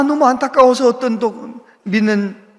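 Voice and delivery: ordinary talk, nothing marked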